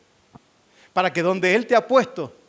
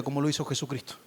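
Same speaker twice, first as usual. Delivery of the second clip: usual speaking voice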